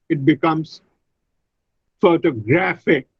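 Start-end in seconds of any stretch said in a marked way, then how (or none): 2.0-3.1 "photographic" stressed on -GRAPH-; stress on third syllable. Shown as a demonstration. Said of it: "The stress is on the".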